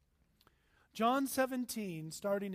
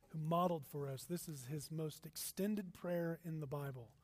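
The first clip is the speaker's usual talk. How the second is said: ordinary talk, nothing marked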